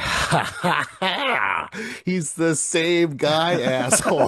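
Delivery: high, laughing mock jeer. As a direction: laugh him down, half teasing. Retold mocking laugh at friend.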